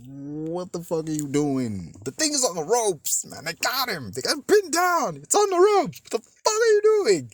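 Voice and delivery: incredulous rant building to a high scream; exasperated outburst. Acted. Viewer yelling at a film character who stops the attack, escalating exasperation. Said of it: "I'm just like".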